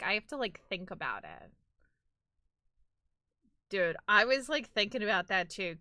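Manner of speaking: ordinary talk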